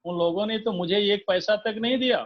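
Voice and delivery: normal talking voice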